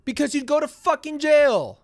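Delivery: loud, high-pitched outburst; exasperated shout. Real, not acted. Host yelling back at the callers, exasperated shout. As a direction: yell it at them, pitch jumping up.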